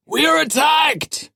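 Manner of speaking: very high, loud, drawn-out alarm; shrill cry. A narrator's reading. Dying watchman warns the ship of attack, shrill cry. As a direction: raise the alarm in a shrill shout. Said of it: "his shrill voice deepening as blood engulfed it".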